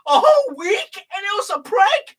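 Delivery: can't believe it was a whole week; shocked disbelief. Real, not acted. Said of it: "reacting to the prank reveal".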